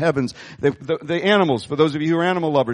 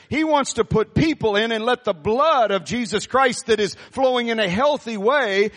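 ordinary delivery

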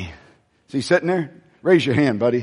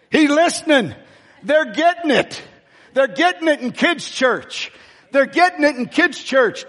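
excited preaching; 8 semitones higher than usual. Real, not acted. Excited after child's story.

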